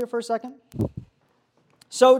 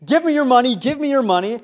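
retold scream; 3 semitones higher, 7 dB louder than usual. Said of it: "bumps into him screaming".

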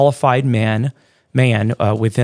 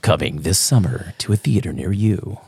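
low mock trailer announcer; deep, playful movie-promo voice; 4 semitones lower, 3 dB quieter than usual. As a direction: announce it like a coming attraction.

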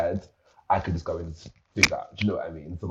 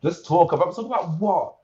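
incredulous; 12 semitones higher, 8 dB louder than usual. Retold or imitated. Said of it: "they're just like".

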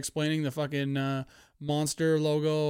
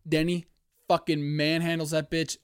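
normal talking voice

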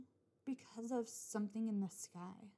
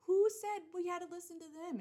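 indignant; 8 semitones higher, 6 dB louder than usual. Real, not acted. Indignant rant question.

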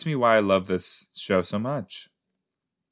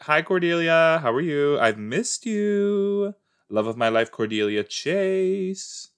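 high, drawn-out adoring greeting; playful gushing; 6 semitones higher, slower than usual. Pretend. Greeting beloved Cordelia on screen, playful gushing. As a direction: coo it with hammed-up adoration.

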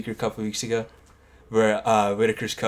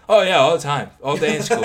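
higher, louder overly cheerful fib; mock breezy lie; 6 semitones higher, 8 dB louder than usual. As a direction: fake confident reassurance. Lying to audiologist.

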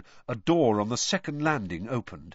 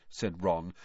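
ordinary delivery, nothing marked

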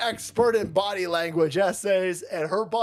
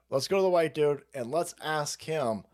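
usual speaking voice

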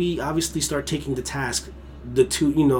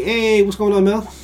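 friendly greeting; 7 semitones higher, 5 dB louder than usual. Retold or imitated. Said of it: "friend of mine say".

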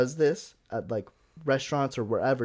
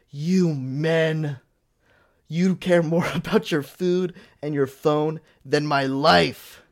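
loud, slow, drawn-out mock rant; retold angry outburst; 3 semitones higher, 6 dB louder, much slower than usual. Woman going off at a man eating, mock angry rant. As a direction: imitate her going off, building to a shout on the last word. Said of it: "she goes off like".

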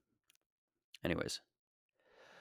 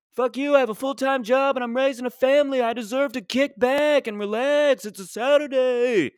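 dad ranting as [name]; 12 semitones higher than usual. Retold or imitated. Comic voicing his angry father as a [name] song. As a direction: belt the dad's tirade in a [name] croon.

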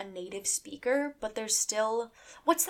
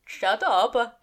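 mock annoyed; 2 semitones higher, 9 dB louder than usual. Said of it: "Our German listeners are like".

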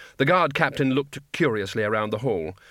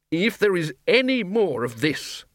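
growling; 6 semitones higher than usual. Acted. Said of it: "growled Ridcully".

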